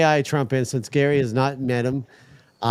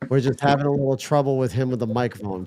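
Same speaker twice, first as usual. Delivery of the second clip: normal talking voice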